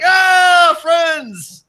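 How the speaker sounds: high, drawn-out roar of mock delight; joyful shout. Drunk Irishmen greeting a new friend, joyful mock shout. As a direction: holler it like greeting old drinking pals.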